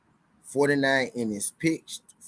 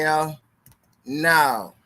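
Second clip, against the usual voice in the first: emphatic refusal; 6 semitones higher, 4 dB louder than usual. Pretend. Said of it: "I'm saying".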